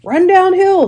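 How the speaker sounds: high, loud animal call; the cows call advice to Wilbur, high and loud. Acted. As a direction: shout it across the barnyard. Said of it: "said the cows".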